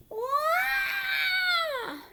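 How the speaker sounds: high loud cry of alarm; mayor's shocked wail. Acted. Mayor Hippo crying out in alarm at the trash. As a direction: let out a sudden high wail.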